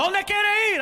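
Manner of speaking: high, loud Spanish demand; yelling cab driver. Retold or imitated. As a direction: bark the question at the passengers. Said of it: "So he's yelling at us".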